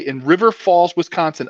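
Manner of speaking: ordinary talk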